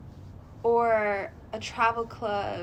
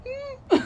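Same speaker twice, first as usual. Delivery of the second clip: scared hmm; 3 dB louder than usual. Retold or imitated. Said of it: "I'd be like".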